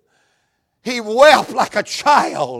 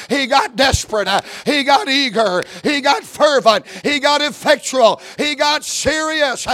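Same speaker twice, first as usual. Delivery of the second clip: rising, shouted preacher's cadence; preaching climax; 3 semitones higher than usual. Acted. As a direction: climb to a shout on each got.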